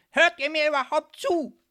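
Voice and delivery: very loud, high shout; exasperated shout. A narrator's reading. Cutting Marie off mid-sentence, exasperated shouting. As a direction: burst in, demanding their attention. Interrupting outburst at the friends.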